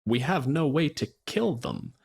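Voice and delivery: ordinary delivery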